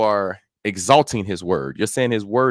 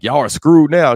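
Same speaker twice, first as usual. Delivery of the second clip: loud, higher-pitched crowing shout; Hulk; 6 semitones higher, 8 dB louder than usual. Retold or imitated. Preacher quoting a movie hero to the monsters, loud and high. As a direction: call it out as a loud mock threat. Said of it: "Hulk was like".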